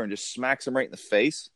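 ordinary talk, nothing marked

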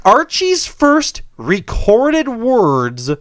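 slow, high, hammed-up reveal; mock grand announcement. Host mocking royal podcast news, overblown slow announcement. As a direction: drag it out like a momentous reveal.

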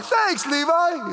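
very high, drawn-out gushing; exaggerated squeal of gratitude; mock-delighted thanks. Preacher thanking Levi for the gift, mock-delighted.

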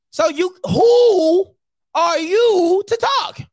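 loud, high, drawn-out challenge; indignant challenge. Real, not acted. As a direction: stretch the words out, confronting a critic.

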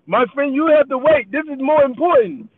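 imitating an Indian cashier, mildly; Indian accent. Cashier telling the customer to wait, light Indian accent. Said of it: "my Indian accent".

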